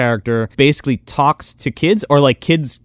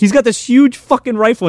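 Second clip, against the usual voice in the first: ordinary delivery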